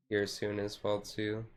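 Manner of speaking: ordinary delivery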